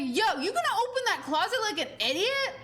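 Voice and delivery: high-pitched heckling, not really shouted; mock scream. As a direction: scold the screen like a rowdy moviegoer. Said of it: "just screamed. He's like".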